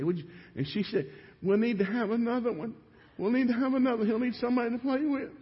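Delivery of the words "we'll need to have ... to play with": much higher, small imitated wife's voice; high mock voice of his wife just after birth; imitating tired wife. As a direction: play the worn-out new mother murmuring.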